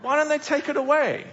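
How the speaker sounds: laughing, exasperated complaint. Retold or imitated. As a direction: throw up your hands with a laugh. Exasperated at litter left by crowds.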